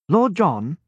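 a hint of a keen young naval lieutenant, respectful; respectful young officer. A narrator's reading. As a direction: greet him politely, a boyish officer. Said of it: "he asked respectfully".

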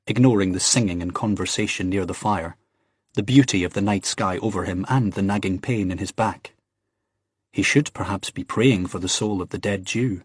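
usual speaking voice

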